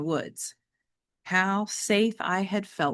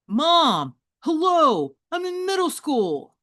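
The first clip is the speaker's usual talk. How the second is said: put-on higher, louder eye-rolling teenager; exasperated teen; 10 semitones higher, 6 dB louder, slower than usual. James scoffing at Mom, exasperated teen voice. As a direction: scoff like a kid who's too old for this.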